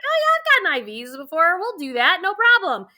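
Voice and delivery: high, breezy and unbothered, as if it's nothing; breezy confidence. Retold or imitated. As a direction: toss it off like an old pro. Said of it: "And I was like".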